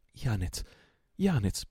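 trembling cry, rising on the second Janez; stammering. A narrator's reading. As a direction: stammer the name in shock. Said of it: "balbettò egli".

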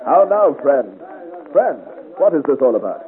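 loud, high hail across the field; calling out. Acted. Officer calling loudly to squabbling soldiers. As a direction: call out to break up the quarrel.

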